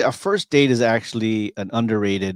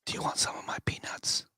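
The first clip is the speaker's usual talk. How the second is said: breathy hushed offer; movie theater whisper; 9 semitones higher, 8 dB quieter than usual. Pretend. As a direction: lean over and whisper the offer. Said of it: "you can whisper in the movies".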